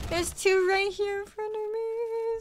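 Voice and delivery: high, urgent callout; enemy spotted shout. Real, not acted. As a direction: call the enemies out fast. Spots enemies mid-game.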